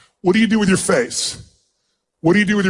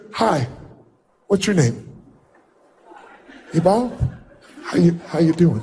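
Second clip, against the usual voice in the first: a touch of fear; 5 semitones lower than usual. Shown as a demonstration. Speaker demonstrating a greeting made in fear of rejection, timid. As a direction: timidly introduce yourself, scared of rejection. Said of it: "like if I came up and said".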